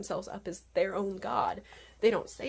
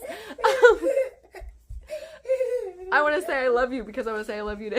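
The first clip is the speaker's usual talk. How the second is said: high laughing sign-off; 13 semitones higher, 9 dB louder than usual. Real, not acted. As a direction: giggle through the goodbye. Laughing, child in frame.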